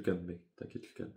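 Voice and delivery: mock reassuring to himself; quietly insisting he is calm. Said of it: "calme-toi".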